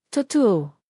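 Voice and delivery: ordinary delivery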